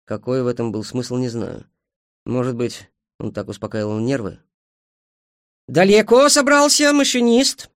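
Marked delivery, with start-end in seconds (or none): 5.7-7.7 loud, high, drawn-out shout; calling out. A narrator's reading. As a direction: call up to a driver from afar. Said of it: "крикнула Эйджел". (громкий протяжный окрик)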